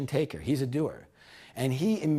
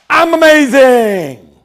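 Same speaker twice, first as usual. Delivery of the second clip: big slow shout, much louder and higher; triumphant shout; 9 semitones higher, 17 dB louder, slower than usual. Shown as a demonstration. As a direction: yell it out in triumph. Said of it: "give yourself a high five and say".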